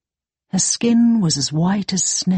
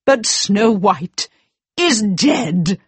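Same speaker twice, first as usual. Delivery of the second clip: slow, insisting wicked queen; a queen who refuses the news; 3 semitones higher, slower than usual. Acted. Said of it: "said the wicked queen".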